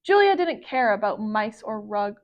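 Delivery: ordinary delivery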